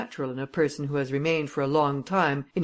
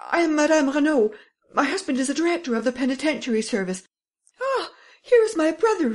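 much higher, louder, panting cries; breathless exclaiming; 11 semitones higher, 5 dB louder than usual. Acted. Lady bursts out to the police, breathless and shrill-high. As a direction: gasp it all out at the police. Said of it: "Panting for breath, the lady exclaimed".

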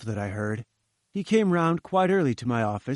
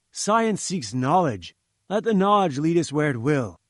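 louder, grand declamation about knowledge; lofty professor orating; 2 semitones higher, 4 dB louder than usual. Acted. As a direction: preach the glory of science to a tradesman. Challenger's grand science speech.

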